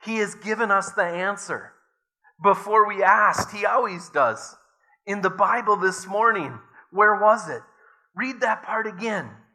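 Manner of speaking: Betsy bursting with a discovery; excited. A narrator's reading. Betsy urging her sister to reread the Bible, excitedly. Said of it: "she said excitedly".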